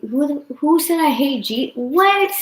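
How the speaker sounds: high, loud outraged questioning, climbing to the last word; real shocked indignation, pitch shooting up; indignant disbelief. Real, not acted. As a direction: react to a false accusation, incredulous. Stung by viewer's false accusation.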